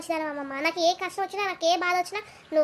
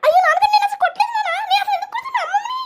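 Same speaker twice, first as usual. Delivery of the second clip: much higher, louder whining denial; wailing son; 12 semitones higher, 5 dB louder than usual. Acted. Son wails to his father that he never hit grandma. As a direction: wail your innocence at dad.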